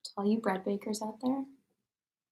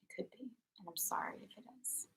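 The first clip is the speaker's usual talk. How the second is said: whisper; 17 dB quieter than usual. Real, not acted. Whispered aside on stream.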